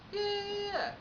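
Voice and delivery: high, sustained run of yeahs; eager caller. Retold or imitated. Client confirming the booking, high-pitched eager agreement.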